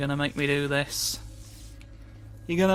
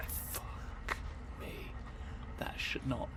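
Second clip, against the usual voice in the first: breathy, much softer muttered swear; whispered curse; 2 semitones lower, 14 dB quieter, slower than usual. Real, not acted. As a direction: curse under your breath.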